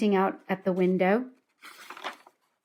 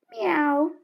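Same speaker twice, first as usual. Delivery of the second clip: high little cat mew; the cat calling to the trapped children; cat meow; 7 semitones higher than usual. A narrator's reading. Cat meowing.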